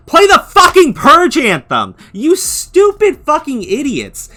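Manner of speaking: drawn-out furious outburst; furious shout. Real, not acted. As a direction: yell it at them in real anger. Opening rant at rioters.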